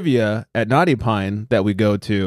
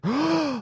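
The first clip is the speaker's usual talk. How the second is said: mock gasp; 6 dB quieter than usual. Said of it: "And I'm like".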